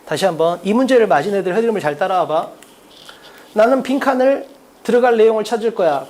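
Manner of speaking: ordinary talk, nothing marked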